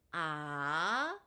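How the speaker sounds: "아" held long, starting low and rising steeply like a question; second-tone rise. Shown as a demonstration. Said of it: "일성부터 사성까지 제가 연이어서". ("아"를 낮은 음에서 높은 음으로 길게 끌어올리기)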